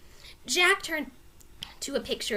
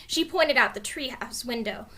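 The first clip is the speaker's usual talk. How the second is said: ordinary delivery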